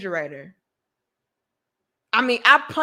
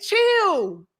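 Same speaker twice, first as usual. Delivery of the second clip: higher calming brother voice; imitating brother soothing, pitched up; 7 semitones higher, much slower than usual. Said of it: "And he said".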